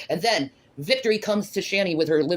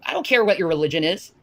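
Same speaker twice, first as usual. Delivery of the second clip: normal talking voice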